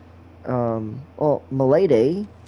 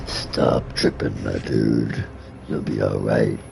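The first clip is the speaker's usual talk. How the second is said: very low, slow demon; deep monstrous voice dragging out the words; 4 dB quieter, slower than usual. Acted. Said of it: "do the demon voice".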